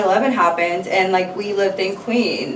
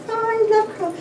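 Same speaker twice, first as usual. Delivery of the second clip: higher, softer cooing pet voice; 12 semitones higher, 4 dB quieter, faster than usual. Real, not acted. Owner murmuring to her cat Coral, soft high baby talk.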